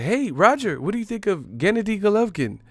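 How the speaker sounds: high-pitched eager interviewer impression; Ellie Seckbach. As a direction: imitate a reporter calling out a question. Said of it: "I remember his voice".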